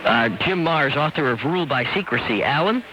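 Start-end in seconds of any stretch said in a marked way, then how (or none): none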